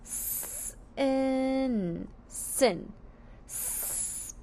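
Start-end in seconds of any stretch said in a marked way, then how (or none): 0.0-2.1 S-in split into two sounds, S then in; sounding out. Shown as a demonstration. Teacher sounding out sin for the class, sound by sound. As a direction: pull S-in apart into its sounds. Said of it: "Let's sound out sin".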